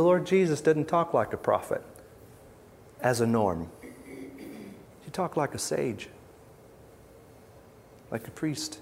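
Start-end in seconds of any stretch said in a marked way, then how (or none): 3.8-8.8 soft, slow whisper. Real, not acted. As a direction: hush it like a secret. Contrasting gentle sage with prophet.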